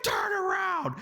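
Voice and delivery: high-pitched shouted order; mock shout. Drunk passenger ordering her driver back, high mock shout. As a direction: imitate an irate passenger yelling a command.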